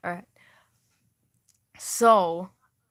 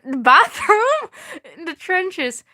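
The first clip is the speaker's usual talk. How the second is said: high, loud disbelieving rise on Bathroom; mock the absurd detail; incredulous laughing question; 7 semitones higher, 4 dB louder, slower than usual. Real, not acted. Streamer baffled by a bathroom in the trenches, disbelieving.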